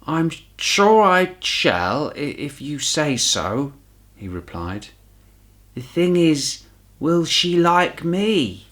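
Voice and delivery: high, slow, hesitant bear voice with a stammer; a small bear whose knees wobble, unsure; nervous bear. Acted. Paddington asks Judy if he'll be liked, nervous and slow.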